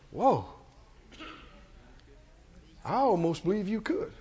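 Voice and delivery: soft, hushed amazement, acted out; awed murmur. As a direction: say it softly, half convinced despite yourself. Acts out stunned hearers of David.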